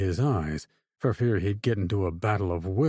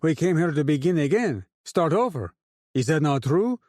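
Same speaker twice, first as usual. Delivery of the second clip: raised, orating to a crowd; rousing speech; 6 semitones higher than usual. A narrator's reading. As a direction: lift the voice to rally the men.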